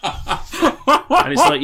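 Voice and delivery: high, drawn-out laughing. Real, not acted. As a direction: stretch it out, cracking up. Laughing build-up.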